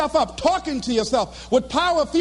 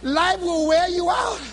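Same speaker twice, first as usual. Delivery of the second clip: normal talking voice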